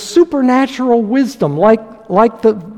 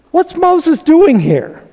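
ordinary talk, nothing marked

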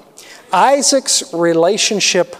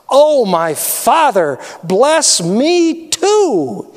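slow, drawn-out plea rising higher on bless me too; begging; 5 semitones higher, much slower than usual. Acted. Esau begs his father for a blessing, slow pleading cry. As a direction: stretch the words out as if begging on your knees. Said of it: "he begged".

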